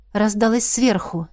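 ordinary delivery, nothing marked

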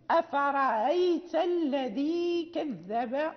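very slow "أفرأيت", hamza between hamza and alif; eased hamza. Shown as a demonstration. Teacher recites the example again, very slowly. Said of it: "همزة مسهلة بين بين".

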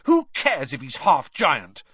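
loud angry interruption; shouting. A narrator's reading. Harry defending Hagrid to the reporter, shouting. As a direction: cut her off with a shout. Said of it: "Harry shouted".

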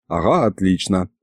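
ordinary delivery, nothing marked

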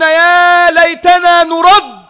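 very slow, loud, high wail; regretful cry. Acted. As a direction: draw out the plea in anguish. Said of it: "يصرخون ويقولون".

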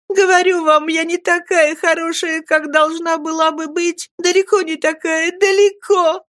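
put-on high, tearful girl's pleading; a young girl's high, weeping protest; sobbing. A narrator's reading. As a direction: sob it out as if her heart would break. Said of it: "И она рыдала так".

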